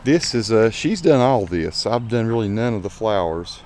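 ordinary delivery

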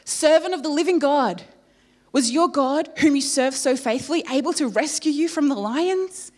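high, loud desperate calling into the den; anguished call. Acted. King calling into the lions' den, anguished. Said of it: "he called out in anguish".

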